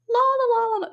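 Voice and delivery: high, loud mock la-la; mocking singsong. Mocking what people think it is, high singsong. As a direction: sing out a high, dismissive la-la. Mocking how others see it.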